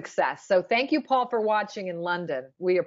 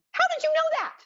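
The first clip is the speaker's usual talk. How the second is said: incredulous; faster than usual. Retold or imitated. Quoting own incredulous thought.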